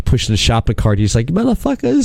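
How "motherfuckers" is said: mock holler. Retelling the guy downstairs pushing the shopping cart, mock holler. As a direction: imitate an indignant holler, pitch thrown way up. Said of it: "the guy downstairs hollering".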